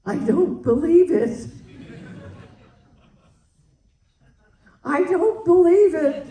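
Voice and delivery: re-enacting her Oscar speech, stunned and loud; stunned exclaiming. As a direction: blurt it out overwhelmed.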